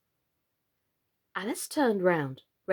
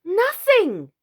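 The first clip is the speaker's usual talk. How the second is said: much higher and louder, snappish; impatient; 11 semitones higher, 7 dB louder than usual. A narrator's reading. Alice answers the frog impatiently, higher and louder. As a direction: snap it, fed up. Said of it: "Alice said impatiently".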